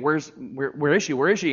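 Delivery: ordinary talk, nothing marked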